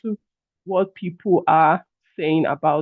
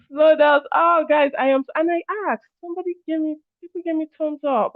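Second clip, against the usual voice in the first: high, laughing disbelief; amused surprise; 10 semitones higher than usual. Real, not acted. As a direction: react with bemused surprise. Amused at slow-down requests.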